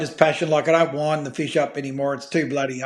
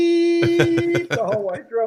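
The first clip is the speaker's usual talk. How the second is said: much higher, slow mock editor voice; editor's voice; 13 semitones higher, slower than usual. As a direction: mimic the editor drawing out the beep. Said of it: "She goes".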